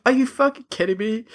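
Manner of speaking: disbelief. Retold or imitated. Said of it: "I was like".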